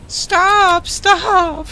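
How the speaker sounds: very high, loud squeal of stop; shrill falsetto plea, hammed up; squeaky mock cry. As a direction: squeak it like a tiny panicked doll. Voicing victim in game.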